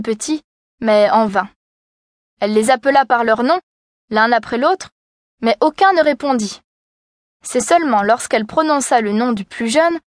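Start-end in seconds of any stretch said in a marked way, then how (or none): none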